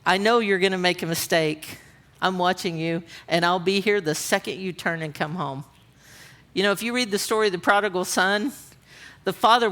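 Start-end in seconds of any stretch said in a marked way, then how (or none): 0.1-5.6 soft, lower, patient promise; quiet, tender assurance; gently reassuring. Acted. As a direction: say it as a loving father keeping watch. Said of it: "He's saying".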